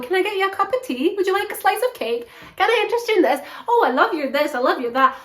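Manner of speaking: fluttering, offering tea and cake; fussy hostess. Retold or imitated. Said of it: "And Joyce is going".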